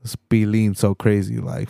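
ordinary talk, nothing marked

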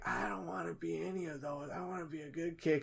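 Adam Sandler voice. Retold or imitated. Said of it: "And Adam Sandler was like".

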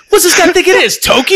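high-pitched comic disbelief, rising at the end; incredulous mock onlooker. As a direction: squeal it as a baffled bystander. Acts onlookers mocking drifting ship.

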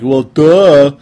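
loud, high mock heckler; scornful listener. Host voicing listeners who will call him an idiot, scornful mockery. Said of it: "some of you are gonna be like".

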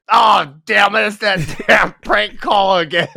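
loud, hammed-up outburst breaking into laughter; mock exasperated shout. Prank-called coach fuming at the phone, mock angry shout. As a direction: yell it as the fed-up prank victim, laughing through.

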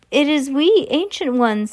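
high, meek little witch; timid announcement, pitched well up. A narrator's reading. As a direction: pipe up high like a humble underling. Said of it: "said a meek voice".

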